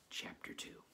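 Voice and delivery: soft whispered heading; hushed aside. Acted. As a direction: breathe the chapter title.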